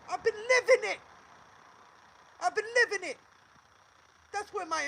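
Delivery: loud, high-pitched indignant outburst; real outraged yell. Real, not acted. Woman answering people who question her information, outraged yell. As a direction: yell it back at the doubters.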